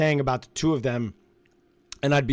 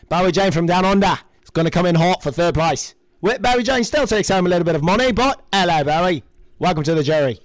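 loud mock Australian accent announcing Barry Jane; Aussie host voice, hammed-up and loud; 6 dB louder than usual. Co-host bids farewell to a houseguest in a mock Australian accent. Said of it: "my Australian accent has gotten so much better".

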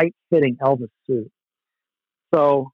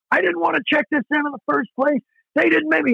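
fast, much higher, heated complaint; irate rant; 13 semitones higher, faster than usual. Retold or imitated. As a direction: tear into the counter agent, words racing.

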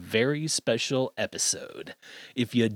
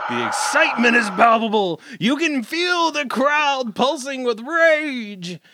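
hype announcer; 11 semitones higher, 3 dB louder than usual. Pretend. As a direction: pump up an imaginary crowd. Mock hype for the anniversary.

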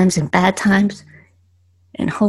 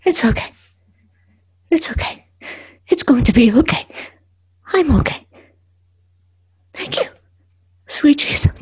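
high, halting self-reassurance; talking to oneself; 7 semitones higher than usual. Acted. Driver calms herself after a panic attack, talking to oneself. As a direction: soothe yourself between short pauses. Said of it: "I talk to myself".

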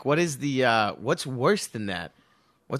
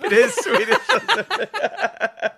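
laughing; 6 dB louder, slower than usual. Real, not acted. Joke lands, speaker cracks up.

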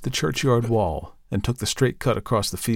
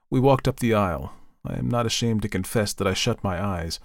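ordinary delivery

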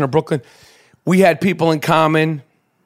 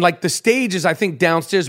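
normal talking voice

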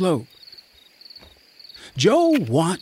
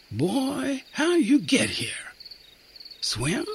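higher, softer, wondering questions; puzzled sergeant; 10 semitones higher, 6 dB quieter, slower than usual. A narrator's reading. Sergeant puzzling over the lost boy, gently questioning. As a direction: coax an answer out of the boy.

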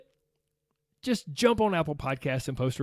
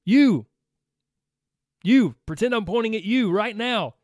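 loud, pointed call to each listener, higher; playful loud call-out; 4 semitones higher, 6 dB louder than usual. Pretend. Host calling out listeners to post reviews, loud playful demand. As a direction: jab the finger at the listener and call them out loud. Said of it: "So this is me saying".

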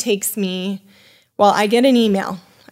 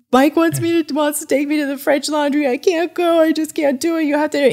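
much higher, anxious begging; panicked plea; 7 semitones higher than usual. Retold or imitated. Begging her husband on the phone, much higher in panic. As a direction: panic as if you truly can't face it.

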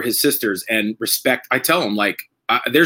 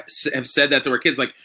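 usual speaking voice

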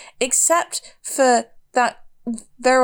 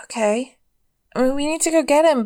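ordinary talk, nothing marked

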